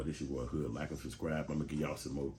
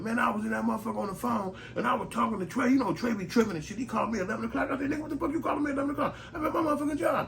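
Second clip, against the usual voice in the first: much higher, fast shrieking rant about a late call; loud rant; 5 dB louder, faster than usual. Retold or imitated. Worker venting on phone at job, high shrieking loud rant. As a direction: go off at full volume like nobody is listening.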